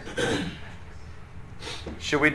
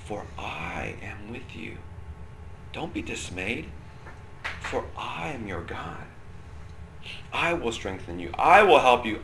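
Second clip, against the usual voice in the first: God's intimate voice; 3 semitones lower, 6 dB quieter than usual. Retold or imitated. Teacher voicing God speaking personally to you, hushed. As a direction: breathe it like God speaking right into your ear. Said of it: "you hear the voice of God saying to you".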